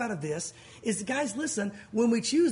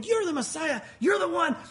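excited crowd shout; 6 semitones higher than usual. Retold or imitated. People waving lulavs hailing Jesus, excited shouting. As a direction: shout it in celebration.

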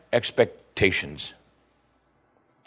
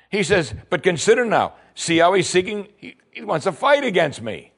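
the frightened king, rattled and fast; panicked king; 7 semitones higher than usual. Retold or imitated. King of Israel panicking over the letter, frantic.

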